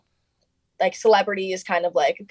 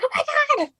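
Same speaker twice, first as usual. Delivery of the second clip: excited gasp. Retold or imitated. [name] freaking out.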